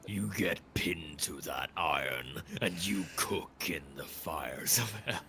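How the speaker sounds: slow, low menacing threat; Markiplier impression. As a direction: drag out a villain's threat. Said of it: "Just mimicking Markiplier now".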